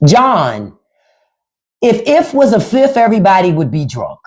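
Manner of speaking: ordinary talk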